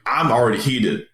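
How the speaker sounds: ordinary talk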